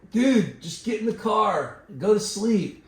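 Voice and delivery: imitating annoyed friend. Sleepy friend in the car to injured man knocking, annoyed.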